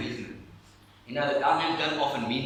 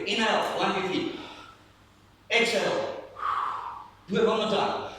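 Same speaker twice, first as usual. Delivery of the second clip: higher, upbeat breathing coach; energetic coaching; 7 semitones higher than usual. Real, not acted. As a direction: pump up the congregation. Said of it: "leading the breathing exercise".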